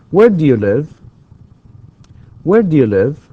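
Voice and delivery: loud, high "Where", then down on "live"; falling intonation. Shown as a demonstration. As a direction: stress "Where" and drop through "do you live". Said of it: "it's falling intonation".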